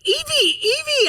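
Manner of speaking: very high panicked cry, re-enacted; retold screaming. Mother screaming to her hurt daughter, re-enacted panic. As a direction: call out the name in acted panic. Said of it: "I'm, like, screaming".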